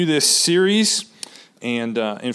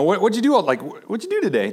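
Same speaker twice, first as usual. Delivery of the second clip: higher, interested, just wanting to know; genuinely curious; 5 semitones higher, faster than usual. Shown as a demonstration. Husband asking wife about her day, genuinely curious. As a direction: ask it kindly, with real interest. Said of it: "Just wanting to know".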